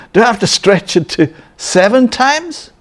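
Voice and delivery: high, laughing, disbelieving whine; mock Peter groaning at the burden; mock reluctance.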